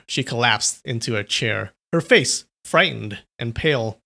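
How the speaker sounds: ordinary delivery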